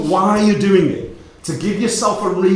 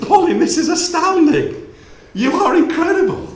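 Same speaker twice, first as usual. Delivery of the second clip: laughing amazement; 12 semitones higher than usual. Retold or imitated. Son amazed on the phone.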